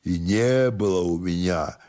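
put-on slow, soft drunken drawl; drunken stubborn servant. Drunken servant denies having a letter, slow stubborn drawl. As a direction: deny it sluggishly and quietly, like a drunk.

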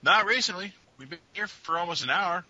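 high, loud shouted reply; stranger calling back. Acted. GM voices NPC answering up.

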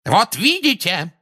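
high, drawn-out exclamation; loud, vindicated cry; triumphant roar. A narrator's reading. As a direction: roar it slowly as proof. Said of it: "взревел Фадж".

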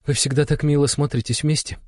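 smiling daughter. A narrator's reading. Alyona voiced after smiling.

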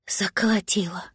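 hushed whisper, eyes shut. Acted. Cat whispering the word, recalling his old home. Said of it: "зажмурился кот".

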